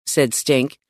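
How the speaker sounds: ordinary delivery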